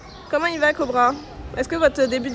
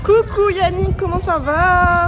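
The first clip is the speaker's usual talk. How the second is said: cheerful greeting; 5 semitones higher, 6 dB louder, slower than usual. Real, not acted. Greeting a viewer.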